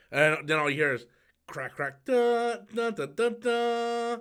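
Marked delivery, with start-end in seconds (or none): 1.5-4.2 sound effect humming. Acted.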